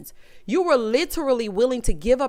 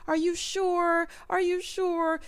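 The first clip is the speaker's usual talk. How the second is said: soft mock plea; 6 semitones higher, 5 dB quieter, slower than usual. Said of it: "She keeps saying to him".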